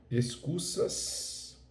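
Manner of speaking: "excusas" with a long, heavy hissed final s; "excusas" stretched into an exaggerated sibilant; Carioca-style plural S. Shown as a demonstration. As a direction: overdo the s ending of "excusas" like a Carioca. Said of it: "falando espanhol no carioquês".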